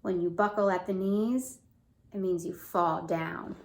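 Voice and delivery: lively storybook read; reading to children. Acted. Mother reading to her son, lively storybook voice. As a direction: read it out to a small child with bounce. Reads picture book to child.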